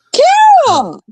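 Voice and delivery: high, loud call across the room; calling out a name. Real, not acted. Greeting a fan in chat, shouting her name high. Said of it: "I do shout her name".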